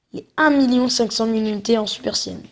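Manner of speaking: ordinary delivery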